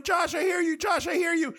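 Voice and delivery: searching man yells toward his friend's voice, imitated; mock frantic shout. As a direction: shout for your friend through the house. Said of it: "Mike is going".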